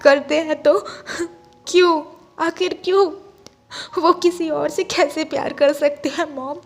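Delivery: tearful, breaking pleas; crying. Acted. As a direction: sob out the questions. Chang weeping to his mother.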